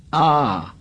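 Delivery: short high "ah" of effort; striking cry. A narrator's reading. Ralph striking with all his might, effortful cry. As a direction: cry out on a hard thrust.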